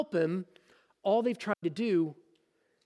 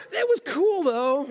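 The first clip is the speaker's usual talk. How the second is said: pleased with himself, mock; 7 semitones higher, 5 dB louder than usual. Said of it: "that creepy voice".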